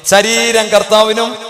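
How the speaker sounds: declaring it out loud for the congregation; loud call-out. Shown as a demonstration. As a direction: proclaim it loudly to wake the hall. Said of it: "ഒന്ന് നന്ന ഒച്ചത്തിൽ പറഞ്ഞേ".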